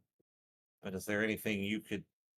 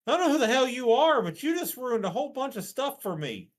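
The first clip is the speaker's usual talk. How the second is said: much higher, louder exasperated yell; angry outburst; 10 dB louder than usual. Retold or imitated. Player's character venting at the stranger, loud and high. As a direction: blast him with frustration. Retold glaring thought, loud.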